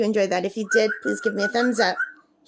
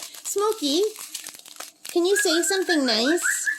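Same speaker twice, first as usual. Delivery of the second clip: higher-pitched pet-talk voice; talking sweetly up high to a pet bird; coaxing a pet; 7 semitones higher than usual. Real, not acted. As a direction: coax the bird in a high, sing-song lilt.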